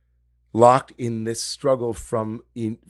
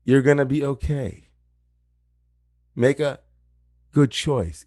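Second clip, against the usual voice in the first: higher, louder gentle urging to calm down; the still small voice steadying Esau before he chooses; reassuring inner voice; 6 semitones higher, 4 dB louder than usual. Acted. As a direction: coax a tired brother to breathe and choose well. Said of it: "that still small voice inside of you saying the same thing".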